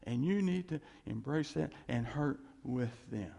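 normal talking voice

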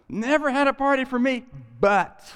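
put-on older son whines to father about the party, loud and indignant; jealous outburst. Indignant loud complaint.

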